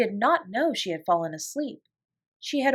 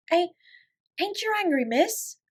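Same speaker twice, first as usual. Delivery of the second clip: high, breathless maid's question; timid servant girl hardly daring to ask; gasping fear; 10 semitones higher than usual. A narrator's reading. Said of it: "she gasped".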